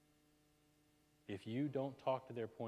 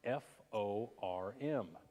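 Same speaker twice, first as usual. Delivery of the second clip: spelled slowly; much slower than usual. Shown as a demonstration. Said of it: "it's called form".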